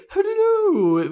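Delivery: very high falsetto nanny hello; Mrs. Doubtfire voice. Retold or imitated. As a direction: chirp it like an old nanny. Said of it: "like Missus Doubtfire".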